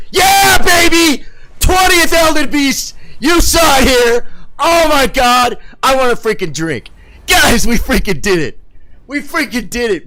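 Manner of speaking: high, full-throated screams of triumph; real, loud, high celebration; ecstatic victory shouting. Real, not acted. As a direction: yell it out like you just won. Celebrating twentieth boss kill.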